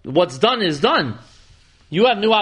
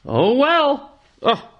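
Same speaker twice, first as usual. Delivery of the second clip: put-on higher, weary, let-down old man's sigh; a touch of resigned disappointment; disappointed old prophet; 5 semitones higher than usual. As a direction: sound like a tired elder let down by his people. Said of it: "you can hear the disappointment in the voice of this old ancient man".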